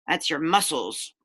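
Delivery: usual speaking voice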